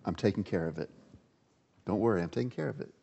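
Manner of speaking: low, soft, reassuring voice; calm God. Acted. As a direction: soothe a worried child from above. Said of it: "Here's God's response".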